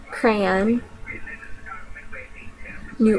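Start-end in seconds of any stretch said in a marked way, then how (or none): 0.1-0.8 "crayon" as one syllable, "cran"; Southern "crayon". Shown as a demonstration. Speaker demos the Southern "crayon" as "cran". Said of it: "second will be Southern version".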